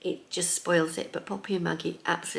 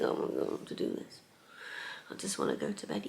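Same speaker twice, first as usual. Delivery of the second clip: weary, softer groan of an exhausted teacher; tired grumpy self; 2 semitones higher, 9 dB quieter, faster than usual. Pretend. After a long workday, telling viewers what they don't want: drained, quiet grumble. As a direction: sigh it out like you can barely stand. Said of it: "the tired, grumpy [name]".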